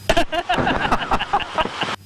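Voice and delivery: quick airy giggle; high breathy laugh. Real, not acted. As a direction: let out a light laugh. Laughs before the landing.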